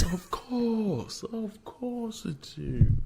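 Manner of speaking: high, slow teasing drawl; mock sarcastic voice. Teasing friend who claims Thor.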